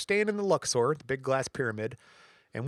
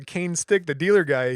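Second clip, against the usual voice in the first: usual speaking voice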